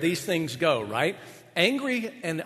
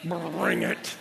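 mock challenge; much slower than usual. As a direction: taunt under your breath. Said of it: "in your mind you're going".

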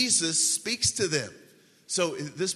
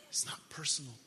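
whispered, very soft aside; hushed; 10 dB quieter than usual. Real, not acted. Preacher tells congregation about empty ritual, hushed whisper. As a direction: drop to a whisper to make it sink in.

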